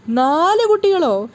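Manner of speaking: a new father stunned by quadruplets; shocked. Acted. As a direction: gasp it out in disbelief. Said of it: "Sachi ശരിക്കും ഞെട്ടിയിട്ടുണ്ടായിരുന്നു".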